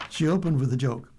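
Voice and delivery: usual speaking voice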